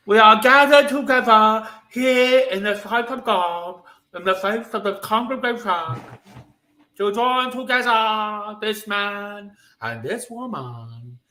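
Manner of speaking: Princess Bride clergyman. Acted. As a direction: intone it like the impressive clergyman. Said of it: "Princess Bride. Let's try this".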